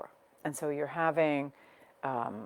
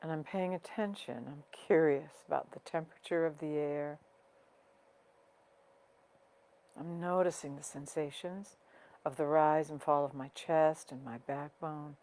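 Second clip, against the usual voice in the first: guided meditation. Real, not acted. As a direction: guide them gently inward, unhurried, letting each phrase settle. Leads a breathing meditation.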